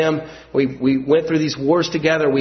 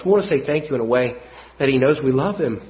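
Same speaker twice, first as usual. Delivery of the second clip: ordinary delivery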